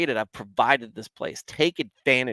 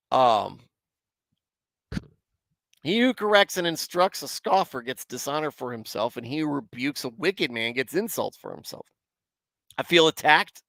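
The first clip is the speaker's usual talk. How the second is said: ordinary talk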